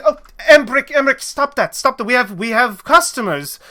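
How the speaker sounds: high, loud fussing at her husband; exasperated high-pitched scolding; scolding wife. Acted.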